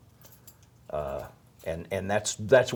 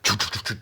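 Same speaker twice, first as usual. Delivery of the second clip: rapid choo-choo jackhammer noise; imitated jackhammer; faster than usual. Storyteller imitating the jackhammer up above, staccato noise. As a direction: make a staccato drilling sound with the mouth. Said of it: "The next thing I hear is a jackhammer".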